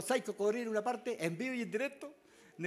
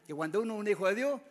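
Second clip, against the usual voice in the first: usual speaking voice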